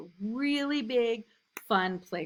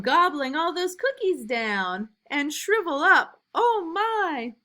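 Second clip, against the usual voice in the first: lively, louder storybook reading; reading to children; 6 semitones higher, 6 dB louder than usual. A narrator's reading. Teacher reading a picture book to new kindergartners, lively and loud. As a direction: read it big and bouncy for little kids.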